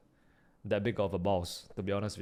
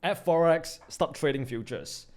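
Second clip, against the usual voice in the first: ordinary delivery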